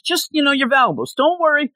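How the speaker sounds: squeaky goon telling hostages not to worry; high pirate voice. Retold or imitated. Pirate tells cruise passengers they only want valuables, high cartoon voice.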